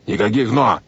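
firm, abrupt refusal; curt cutting-off. A narrator's reading. Captain silences the boy's objection, curt. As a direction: cut the objection dead. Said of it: "отрубил капитан Шем".

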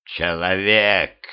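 slow, drawn-out beast growl; growling creature. A narrator's reading. Creature addressing the hated human, slow growl. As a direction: growl it out slowly. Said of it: "прорычала тварь".

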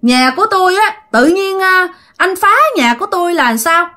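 high-pitched female ghost voice, held up throughout; ghostly woman accosting him. Acted. Ghost woman stops the man who wrecked her house, high accusing voice. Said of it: "cái giọng người này là giọng người phụ nữ ... thì cái người này nói rằng".

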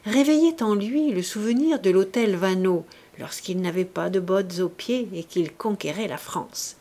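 plain reading voice

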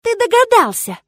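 high, loud cry of realisation; astonished outburst, voice shooting up; shocked accusation. A narrator's reading. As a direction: exclaim it as the truth hits you. Sudden realisation, accusing him.

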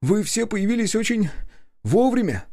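very high, strained captive's voice; loudening relief on the edge of hysteria; high-pitched rescued man, near a nervous laugh; near-hysterical journalist. A narrator's reading. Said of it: "Короткий, почти истерический смешок вырвался".

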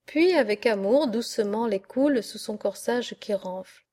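plain reading voice

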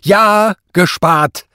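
high, very loud shout; exasperated judge's yell; furious scream. A narrator's reading. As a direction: scream it at the accused. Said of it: "schrie der Richter".

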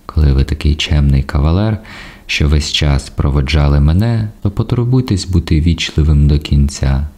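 usual speaking voice

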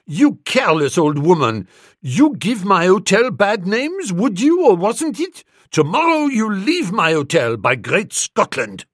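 loud, high-pitched foreign hotelier's tirade; outraged hotel manager. A narrator's reading. Hotel manager throwing out careless guest, outraged cry. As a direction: rage at the guest in a put-on voice. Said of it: "cried the whiskered marvel".

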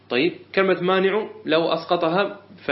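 usual speaking voice

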